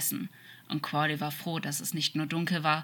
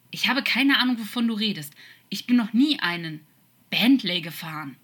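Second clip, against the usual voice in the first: loud, higher irritated protest; exasperated raised voice; indignant denial; 5 semitones higher, 7 dB louder than usual. Acted. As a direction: deny it forcefully, offended. Angel indignantly denies it.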